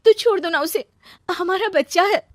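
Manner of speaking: high, loud, plaintive appeal; high-pitched, distraught outburst; desperate pleading. Acted. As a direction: beg a former husband. Begs former husband to return.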